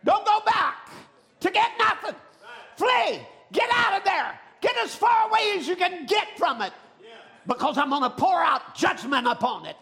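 high, shouted commands to flee; full-force preaching. Real, not acted. Preacher warns believers to flee coming judgment, shouted preaching. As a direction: urge them away like an alarm.

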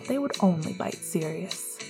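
ordinary delivery

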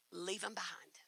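hushed resolve; whispered. Retold or imitated. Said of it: "Leave 'em behind".

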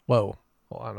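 ordinary delivery

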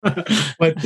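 laughing through the words. Real, not acted. Cracking up at his own rider joke, laughing.

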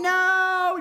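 high, loud sudden no; panicked no. Retold or imitated. Catching his own harsh words, a panicked cry of no. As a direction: yelp it out as the words slip.

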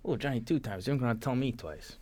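mock Italian accent. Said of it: "My Italian was, like, a little bit mixed".

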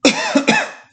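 sudden harsh cough. Real, not acted. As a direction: cough hard, throat caught. Coughing on recording.

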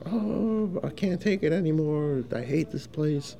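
high, whiny complaining coworker, a retold imitation; fed-up coworker. Coworker storming in, fed up, mock whiny complaint. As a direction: whine it like you're sick of this place.

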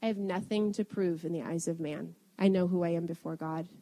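low, very soft inward declaration; quietly reassuring yourself; calm self-assurance. Retold or imitated. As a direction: settle yourself after a breath, hushed and steady. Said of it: "literally taking a breath and just saying".

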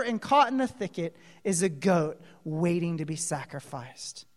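ordinary talk

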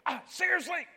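high, exasperated disbelief; mock disbelief. Real, not acted. Preacher to a forgetful congregation, in mock disbelief. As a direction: pitch it up in playful dismay.

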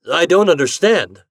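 ordinary delivery, nothing marked

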